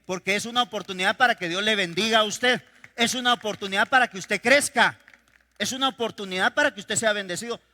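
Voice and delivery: loud preacher's refrain, hammering "para"; preaching at full force. Acted. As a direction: build the repeated line like a pulpit chant. Repeating anaphora to congregation. (predicación enfática y fuerte)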